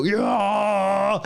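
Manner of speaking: high far-off yell; mock stream-opening scream; retold scream. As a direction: scream at the camera to kick things off. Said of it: "I would literally scream at the camera".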